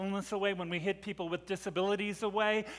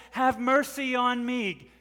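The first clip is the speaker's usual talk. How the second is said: raised, louder plea; begging; 5 semitones higher, 5 dB louder, slower than usual. Acted. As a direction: beg urgently for mercy. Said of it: "She's begging as she stands there".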